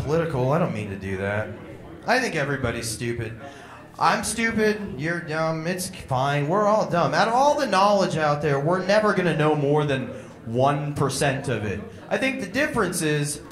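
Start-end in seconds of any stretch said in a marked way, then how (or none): none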